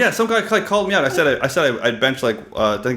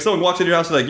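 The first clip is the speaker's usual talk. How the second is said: normal talking voice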